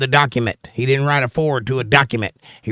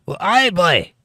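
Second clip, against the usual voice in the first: put-on Scottish accent; 5 semitones higher, slower than usual. Greeting a viewer from Scotland.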